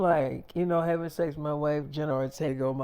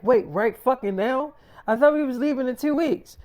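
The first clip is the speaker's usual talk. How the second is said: shocked outburst; 6 semitones higher than usual. Retold or imitated. Wife shocked at leaving now.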